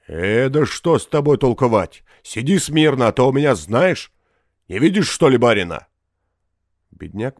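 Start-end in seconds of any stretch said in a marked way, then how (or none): 0.1-5.9 loud, higher-pitched scolding; stern rebuke. A narrator's reading. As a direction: put him sharply in his place. Forester rebukes the peasant.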